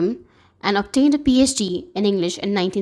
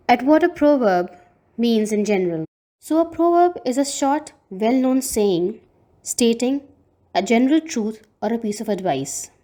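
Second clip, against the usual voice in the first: normal talking voice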